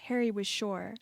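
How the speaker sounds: ordinary delivery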